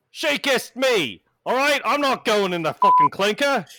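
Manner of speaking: loud, high British publican throwing people out; indignant British pub landlord; British accent. Acted. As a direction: shout it as an angry British barman. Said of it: "every single British accent of yours is the same".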